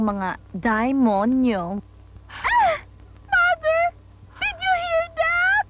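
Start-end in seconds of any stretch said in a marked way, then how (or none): 2.3-5.6 high slow gasping outrage; shocked squeal. Acted. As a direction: squeal in mock shock, slowly.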